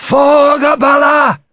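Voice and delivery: high, slow, roaring Irish battle cry; yelled battle cry. Acted. Irish major launching the charge, full yell. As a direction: let the war cry ring out. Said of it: "Major Goff yelled".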